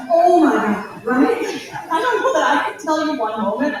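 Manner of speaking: amused, laughing disbelief. Real, not acted. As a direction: laugh through the words in disbelief. Laughs at absurd old prompt.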